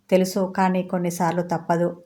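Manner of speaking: ordinary delivery, nothing marked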